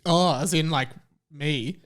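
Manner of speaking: ordinary delivery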